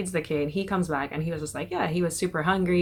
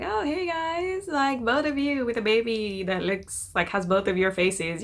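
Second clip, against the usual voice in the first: high mock Kelly greeting; 5 semitones higher than usual. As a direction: greet them like an over-eager weird friend. Kelly greets the couple weirdly.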